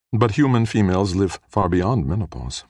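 ordinary delivery, nothing marked

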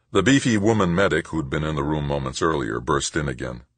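ordinary delivery, nothing marked

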